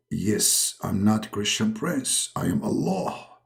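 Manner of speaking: low, slow divine voice; low and deliberate like God; Allah voice. Acted. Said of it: "he call him".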